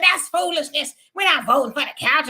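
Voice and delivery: hammed-up scolding of a caller; mocking Al Sharpton. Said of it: "He said".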